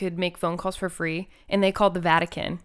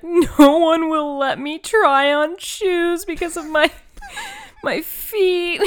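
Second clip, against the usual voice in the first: mock crying; 9 semitones higher, 6 dB louder, much slower than usual. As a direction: fake sobbing plea, higher. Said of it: "Be like".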